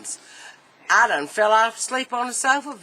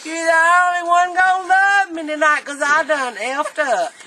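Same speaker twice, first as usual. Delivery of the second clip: put-on high whiny drunk voice; drunk whining; 6 semitones higher than usual. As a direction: whine it like a sorry drunk. Drunk husband whining to dog.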